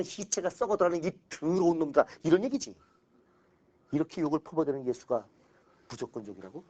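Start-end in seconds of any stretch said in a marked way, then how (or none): 0.0-2.1 mock insult. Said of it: "예수가 잘한 욕 중에 하나야".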